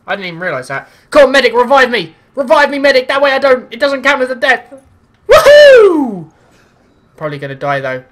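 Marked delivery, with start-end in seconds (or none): 1.1-6.3 high, loud plea for a medic; man down shout. Real, not acted. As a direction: yell for a revive. Downed, calling for revive.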